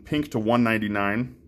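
ordinary delivery

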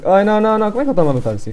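ordinary talk, nothing marked

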